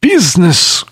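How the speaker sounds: high anguished ghost cry. A narrator's reading. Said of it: "cried the ghost".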